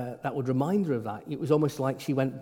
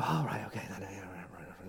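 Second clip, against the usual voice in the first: very soft, trailing resigned mutter; lightly voiced weary priest; mumbling priest; 8 semitones lower, 12 dB quieter than usual.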